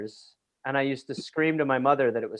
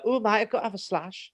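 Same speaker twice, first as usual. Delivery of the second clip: higher, softer mock English lad; put-on English accent; 6 semitones higher, 5 dB quieter than usual. Boy using English slang on American classmates, put-on English accent. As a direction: play a kid showing off English slang, English accent. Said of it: "so I'd be like".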